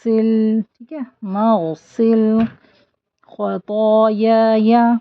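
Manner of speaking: slow melodic Arabic recitation; slow chanted dua; Quran-style recitation. Shown as a demonstration. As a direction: recite slowly with tajweed. Recites phrase.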